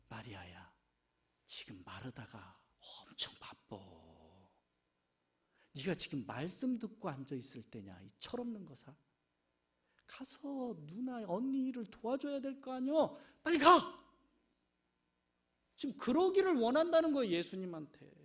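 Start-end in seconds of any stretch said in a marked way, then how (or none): 0.1-8.9 very low, soft, hushed rebuke; Jesus gently scolding. Retold or imitated. Jesus quietly rebuking Mary, as Martha wishes, very low and soft. 10.1-14.0 rising urging. Retold or imitated. Jesus urging Mary to go.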